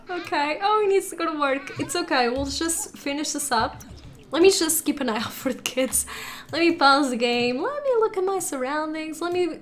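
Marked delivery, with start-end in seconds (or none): none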